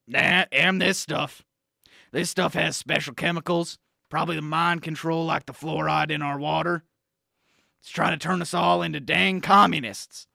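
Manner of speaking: blustery talk-radio conspiracy crank; conspiracy pundit rant. Acted. Pundit badgering the host Sam about Gatorade, blustery rant. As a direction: rant like a paranoid supplement salesman.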